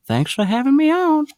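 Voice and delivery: very high old-man cartoon voice; high, put-on character voice; Herbert impression. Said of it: "I do have Herbert".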